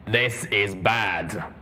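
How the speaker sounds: very slow, grim armored voice; heavy, slow warning. Acted. Steelhooves voice.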